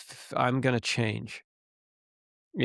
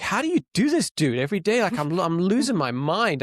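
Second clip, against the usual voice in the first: exasperated plea; 6 semitones higher, 4 dB louder than usual. Retold or imitated. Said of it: "I'm like".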